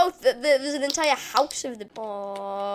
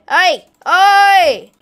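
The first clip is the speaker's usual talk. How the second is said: loud, sharp challenge call; 5 semitones higher, 13 dB louder than usual. Pretend. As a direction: holler to get the enemy's attention. Calls out to game enemy.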